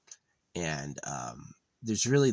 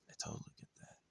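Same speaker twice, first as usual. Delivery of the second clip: "I totally get that" whispered, much softer; 17 dB quieter, much faster than usual. Shown as a demonstration. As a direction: breathe it out as a toneless whisper. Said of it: "repeated in whisper".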